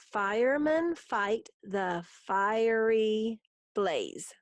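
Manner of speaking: very slow, drawn-out beats for counting; syllable by syllable. Shown as a demonstration. Teacher counting seven syllables in the haiku, syllable by syllable. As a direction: stretch each syllable so it can be counted. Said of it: "That's seven".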